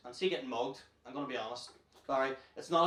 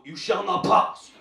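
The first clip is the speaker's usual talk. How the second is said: loud, drawn-out wizard's command, higher than usual; booming wizard; 4 semitones higher, 11 dB louder, slower than usual. Acted. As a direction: bellow it slowly like a wizard barring the way. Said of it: "Lord of the Rings fan ... go".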